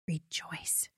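a hushed, breathy whisper of rejoice. Acted. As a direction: let the word fall into a whisper. Repeating rejoice in scripture.

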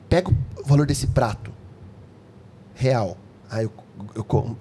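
usual speaking voice

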